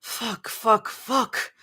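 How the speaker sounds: understated frustrated swearing; mild story-read hiss; hissed cursing. A narrator's reading. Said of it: "Mira hissed".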